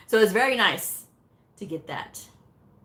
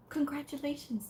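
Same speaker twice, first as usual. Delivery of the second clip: soft, higher-pitched good news; gentle imitation of a congratulation; hushed congratulations; 9 semitones higher, 9 dB quieter than usual. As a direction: say it softly as the test lady. Exam lady announces the result.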